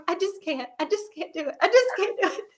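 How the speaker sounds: high, soft, whimpering mock sob; imitating her own crying. Retelling her labour to friends, high, soft mock crying. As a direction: replay yourself falling apart in labour, half laughing. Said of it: "crying and going".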